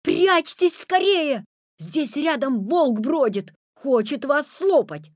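loud, high, slow warning shout. A narrator's reading. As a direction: yell the warning urgently. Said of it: "закричал".

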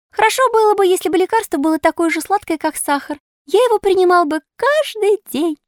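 high-pitched eager puppet voice; eager sweet-toothed puppet. Acted. Puppet licking sugar off his lips, eager and wishful. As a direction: pipe up high, wishing aloud.

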